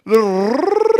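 a long trilled rrrr; rolling R. Shown as a demonstration. Mocking the caller's rolled R, a long trilled rrrr. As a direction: roll the rrrr hard and long. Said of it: "The rolling R".

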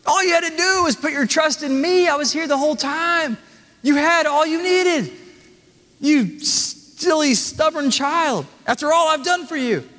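high, reproachful scold; mock scold like a disappointed parent; scolding father. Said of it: "Will he scold you and say".

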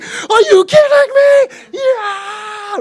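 very high, screaming glee; losing his mind. Retold or imitated. Preacher imitating a kid getting a Nintendo, ecstatic scream. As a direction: shriek like a kid overjoyed by a gift. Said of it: "he loses his mind".

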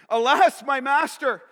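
loud, high cry of alarm; freaked out. Acted. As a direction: call out to your master in panic. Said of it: "He's worried. He's freaked out".

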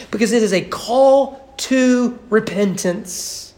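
ordinary talk

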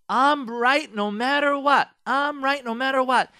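loud, high-pitched insistence; mock stubborn conviction. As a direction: proclaim it like someone who is never wrong. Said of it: "so convinced that they're never wrong".

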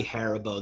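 Haribo said HAIR-uh-bo; favoured pronunciation. Shown as a demonstration. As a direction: stress Haribo on its first syllable, HAIR. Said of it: "it's gotta be Haribo".

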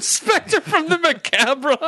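very high, slow hammed-up echo; mock spooky echo. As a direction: savor each word theatrically. Echoing the grandma line.

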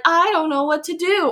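high, mock-helpless girl voice; helpless love interest. Host imitating the girl caught between two men, mock helpless. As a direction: play the torn heroine, pitched well up. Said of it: "she's like".

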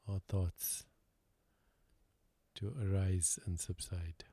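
calm, unhurried meditation guide with long pauses; guided meditation. Real, not acted. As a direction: lead them gently inward, leaving silence between phrases.

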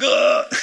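high, loud mock groan of disgust; a sharp disgusted ugh. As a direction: groan like the taste was awful. Congregation recalling covid taste loss.

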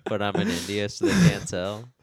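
ordinary talk

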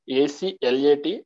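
ordinary talk

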